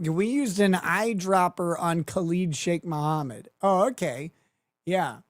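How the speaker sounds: usual speaking voice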